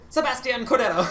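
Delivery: high, loud mock Spanish accent; very thick accent. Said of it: "said it with a very thick accent".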